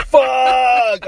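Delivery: loud high burst of excitement; excited shout. Retold or imitated. Friend recalling learning of the trilogy, loud high excited shout. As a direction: blurt it out, thrilled. Retold thrilled reaction to news.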